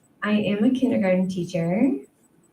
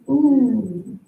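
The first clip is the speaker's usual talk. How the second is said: ordinary talk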